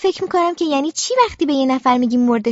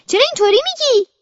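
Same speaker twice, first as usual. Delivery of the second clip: high, louder indignant cry; loud protest; 10 semitones higher than usual. A narrator's reading.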